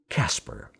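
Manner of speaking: ordinary delivery, nothing marked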